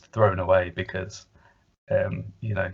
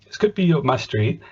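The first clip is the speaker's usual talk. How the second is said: lightly acted hopeful surprise, pitched up; perked-up interest; 9 semitones higher than usual. Co-host retelling his reaction to the spook arriving, perked-up interest. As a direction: recall your interest suddenly picking up.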